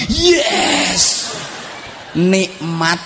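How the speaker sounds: high, drawn-out cheer; triumphant shout. Acted. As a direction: celebrate a victory with a yell. Acting joy at radio working. (sorakan kemenangan)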